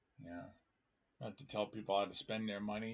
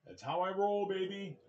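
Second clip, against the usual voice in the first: higher, playful swagger; sing-song swagger; 9 semitones higher, 3 dB louder than usual. Real, not acted. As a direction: toss it off cocky and high.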